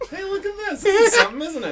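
high, loud mock wonder; mock excited. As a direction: gush over it sarcastically. Mock excitement at old church.

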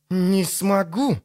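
stretched, spiteful retort; seething through teeth. A narrator's reading. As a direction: draw it out through gritted teeth. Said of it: "процедила она".

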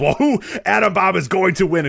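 high, loud mock Vince McMahon call; imitating Vince McMahon. Host mocking Vince's confident pick, hammed-up high announcer voice. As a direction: ham up the excited announcer pick. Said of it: "Vince McMahon goes".

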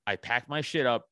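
usual speaking voice

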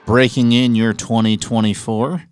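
slow, grand announcer delivery; big show-opener voice, stretched out; podcast host intro. Acted. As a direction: announce it with drawn-out, punched words. Host performs the show's opening.

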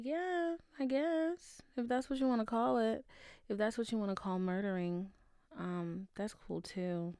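sarcastic shrug in the voice. Real, not acted. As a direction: say it dripping with sarcasm. Sarcastic mock agreement.

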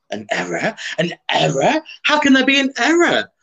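rising, building mock incredulity; mock disbelief. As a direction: repeat it in growing disbelief, ending high. Acts shocked at a posting error.

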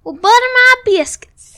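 playful Southern country accent. Shown as a demonstration. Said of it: "Let's hear your country accent".

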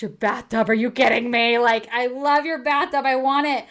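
Andy raving over the bathtub, loud and gushing. Retold or imitated. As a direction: rave about it like a kid in a store.

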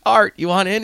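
high, loud mock invitation; imitating eager players. Coach imitating players inviting Art, eager high call.

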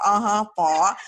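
"Var" said loud in a Southern Dallas accent. Shown as a demonstration. Host pokes fun at how she says "Var", Southern Dallas accent. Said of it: "that's my Southern Dallas accent".

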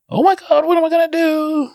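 high, loud whiny mock despair; mock kid panic. Dad mocking a kid whose phone got taken, in a high panicked wail. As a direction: squeal it like a kid losing the tablet. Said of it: "they are like".

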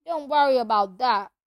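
big booming half-giant; rough country giant voice; gruff giant voice. Acted. Hagrid voice held.

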